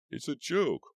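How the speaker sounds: high, loud nervous cry; cried out. A narrator's reading. Mummy crying out to the room, high and loud. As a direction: shout it to convince everyone. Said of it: "one of the mummies cried".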